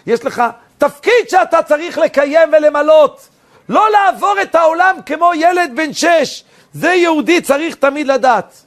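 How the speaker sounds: high, loud preacher's delivery; impassioned preaching. Real, not acted. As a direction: ring it out with full force.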